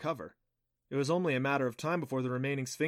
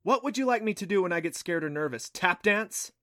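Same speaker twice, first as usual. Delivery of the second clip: higher, exasperated retort; impatient; 6 semitones higher, 5 dB louder than usual. Acted. Clancy retorting about her hunched shoulders, impatient and higher. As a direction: throw it back with a touch of exasperation. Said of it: "She sighed impatiently".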